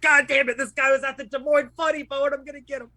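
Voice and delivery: imitating the Uber driver plotting revenge; vengeful driver. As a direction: ham up a furious grudge.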